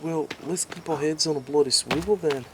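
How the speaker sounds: rally for a fight; resolved mate. Acted. Richard telling Sal to watch out, determined.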